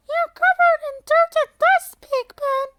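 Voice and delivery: put-on high, loud bossy girl; Lucy's voice. A narrator's reading. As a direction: lecture him in a high girl voice. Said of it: "Lucy tells him".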